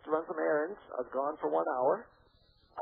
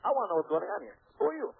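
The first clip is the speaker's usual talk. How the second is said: demanding; 5 semitones higher, 3 dB louder, faster than usual. Retold or imitated. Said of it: "I said".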